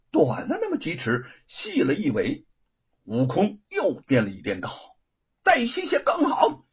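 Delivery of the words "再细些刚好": high, lifted request; Monkey King. A narrator's reading. Monkey King wishing the treasure thinner, high voice.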